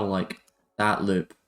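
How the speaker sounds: usual speaking voice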